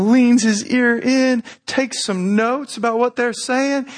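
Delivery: ordinary talk, nothing marked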